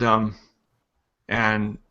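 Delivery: normal talking voice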